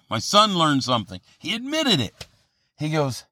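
ordinary delivery

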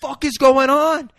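high, loud mock outburst; shocked teacher's yell. Teacher to class of fake-dying kids, mock shocked yell. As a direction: imitate a teacher yelling in disbelief.

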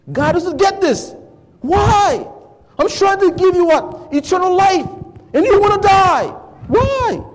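very high, loud pleading cry; passionate plea. Real, not acted. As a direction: beg them to choose life. Voicing God's plea.

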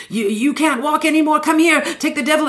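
high, hammed-up faith-healer preaching; televangelist healing. Preacher casting the devil out of a woman's ears, hammed-up and high.

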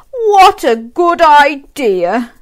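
drawn-out delighted agreement; Zog's eager voice. A narrator's reading. Zog answering the girl, slow and pleased. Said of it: "said Zog".

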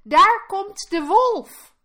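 high, loud, drawn-out warning; alarmed call. A narrator's reading. Piglet alerts brothers to the wolf, calling out. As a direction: cry out the danger slowly and high. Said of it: "riep het oudste biggetje".

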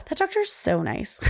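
a hint of a small child gushing. Daughter Sawyer quoted in story.

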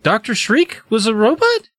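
high, incredulous rising question; acted disbelief. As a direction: play astonished, climbing at the end. Jerry stunned by the revelation.

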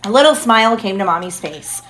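usual speaking voice

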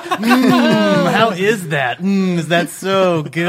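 high, loud, slow cooing; cooing feeding voice. Retold or imitated. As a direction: coo like feeding a baby.

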